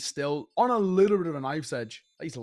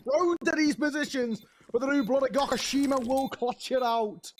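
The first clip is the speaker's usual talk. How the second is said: excited; 10 semitones higher than usual. Real, not acted. Caster hypes a clutch attempt.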